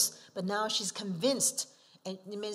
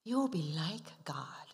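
sly tempting whisper; serpent whisper; 3 semitones lower, 4 dB quieter than usual. Acted. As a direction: whisper the lie like a snake. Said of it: "serpent will propose this to you".

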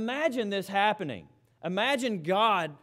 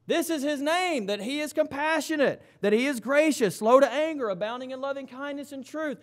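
higher, rising to full preaching force; fervent preaching; 7 semitones higher, 3 dB louder than usual. Acted. Preacher declaring God's name to the congregation, fervently higher. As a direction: lift the whole line as a declaration.

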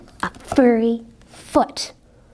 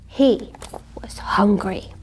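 hushed, breathy whisper; 5 semitones lower, 4 dB quieter than usual. A narrator's reading. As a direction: whisper it low. Hungry wolf narration, whispered.

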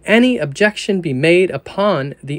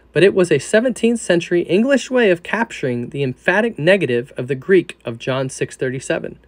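usual speaking voice

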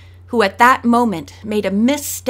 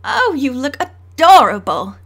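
bright, louder gushing exclamation; excited exclamation; 3 semitones higher, 6 dB louder than usual. A narrator's reading. Daughter gushing over the fairy costumes, excited and louder. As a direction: gush over the girls' costumes. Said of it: "Donna May exclaimed".

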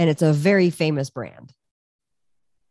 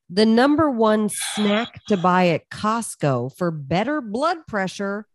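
slow headline read; 3 semitones higher, slower than usual. Acted. Reading headline slowly for effect.

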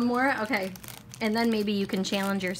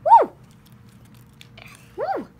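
excited; 11 dB louder than usual. Real, not acted.

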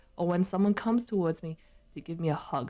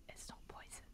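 barely audible whispered answer; 27 dB quieter than usual. Real, not acted. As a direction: whisper it very softly, with no voice.

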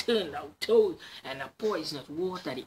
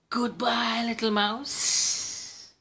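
higher, slow, drawn-out farewell; Snake voice; 8 semitones higher, 5 dB louder, much slower than usual. Acted. Snake leaves the mouse, slow high farewell. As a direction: stretch it out while slinking off.

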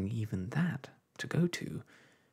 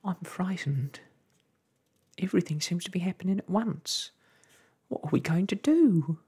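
frightened; 10 semitones higher, 5 dB louder than usual. A narrator's reading. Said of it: "said Homily soberly".

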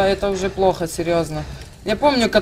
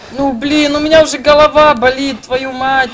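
ordinary talk

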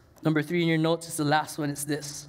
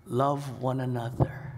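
tender command; 5 semitones lower, slower than usual. Shown as a demonstration. Preacher to his church, slow and tender. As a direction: lay it down gently and slowly.

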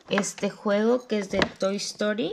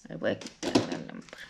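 whispered aside; 7 dB quieter, much faster than usual. Real, not acted. Aside while adjusting lamp.

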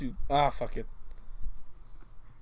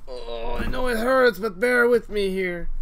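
higher, slow soothing voice; tender comforting; 9 semitones higher, slower than usual. Pretend. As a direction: coax it gently like a hurt pet. Comforting the pumpkin while carving.

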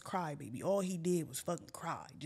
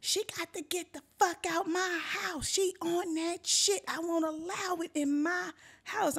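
mock screaming; 8 semitones higher than usual. Dad's girlfriend ranting about the friend, mock screaming. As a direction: imitate a screaming rant without full volume. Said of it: "my dad girlfriend at the time was screaming".

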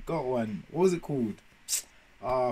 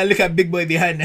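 laughing; 4 semitones higher, 8 dB louder than usual. Real, not acted. Laughing at the video.

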